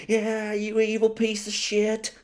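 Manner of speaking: high, slow mock God voice; hammed-up jab at a sinner, drawn out. God mocking the sinner, high, slow mock voice. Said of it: "he's gonna be like".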